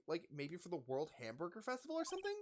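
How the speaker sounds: normal talking voice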